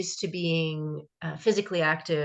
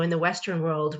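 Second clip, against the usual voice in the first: ordinary talk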